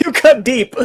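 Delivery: laughing through the words. Real, not acted. Teasing a co-host about his comeback, laughing.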